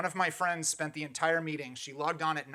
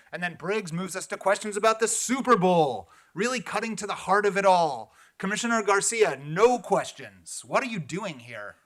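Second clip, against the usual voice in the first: loud, higher-pitched mock praise; sarcastic scolding; 4 semitones higher, 6 dB louder than usual. Real, not acted. Public commenter scolding commissioners about Super Bowl questions, sarcastic. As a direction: call them out with loud sarcasm.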